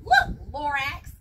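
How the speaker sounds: loud, sharp call then rising; lightly voiced Onceler brushing off the Lorax; dismissive. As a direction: wave him off impatiently.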